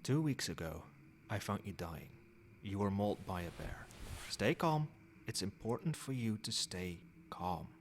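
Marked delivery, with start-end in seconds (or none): 4.3-4.9 raised, louder interjection to soothe; urgent calming. Acted. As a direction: cut in to calm them down. Patient alarmed at bear news.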